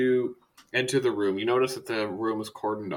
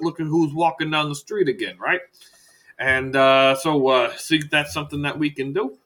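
bursts in loud, then rattles on; folksy doctor talking up a gadget; chatty salesman doctor; 3 semitones higher, 5 dB louder than usual. Acted. Doctor pitching prosthetics to a patient, chatty and breezy.

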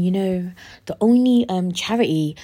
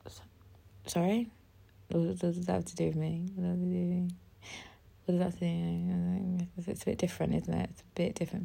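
much softer, flat disbelief; quiet deadpan; 12 dB quieter, faster than usual. Real, not acted. Answering people who compare glasses to blindness, softly deadpan.

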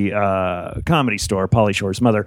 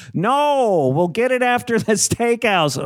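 put-on much higher stubborn old woman; mock Mitzi; 10 semitones higher, slower than usual. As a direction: dig in like a spoiled boss, pitched up. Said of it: "I'm like".

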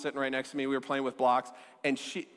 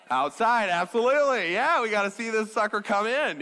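louder, eager storm-chaser cheer; excited Midwesterner; 4 semitones higher, 4 dB louder than usual. Pretend. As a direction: egg the crowd on like a thrilled storm watcher.

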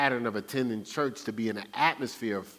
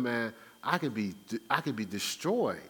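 ordinary talk

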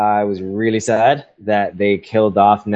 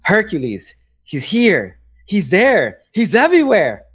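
imagined battle cry, climbing higher with each phrase; Valkyrie; 9 semitones higher than usual. Pretend. Valkyrie calling out Hercules darting around the fight, in a rising mock cry. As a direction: build a playful chant to a high peak. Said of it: "Valkyrie just goes".

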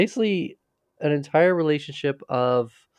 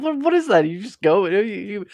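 incredulous mock; 8 semitones higher than usual. Mocking the dad's words.

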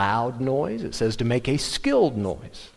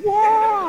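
high, loud imitated wail in a southern twang; loud southern-twang singing; 6 dB louder than usual. Preacher mimicking a lady's loud church singing, southern twang. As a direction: belt out a mock twangy sung note. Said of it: "singing in that southern twang".

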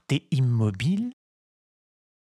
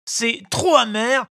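high whining protest; whiny child; 12 semitones higher, 4 dB louder than usual. A narrator's reading. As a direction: complain it's too bitter. Pinocchio protests. (voix d'enfant qui proteste)

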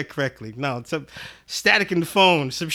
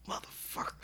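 whispered curse; 18 dB quieter than usual. Retold or imitated. Inner curse in the moment.

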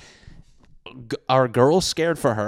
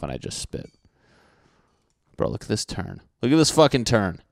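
ordinary delivery

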